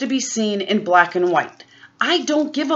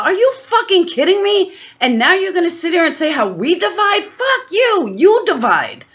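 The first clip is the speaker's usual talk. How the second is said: much higher, furious shout; furious disbelief; 8 semitones higher, 3 dB louder than usual. Real, not acted. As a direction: hurl it back at them. Furious peak of rant.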